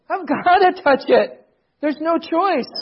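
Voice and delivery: high-pitched insistence, mildly acted; exasperated father. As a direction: plead your case as an exasperated dad. Exasperated father argues with his child.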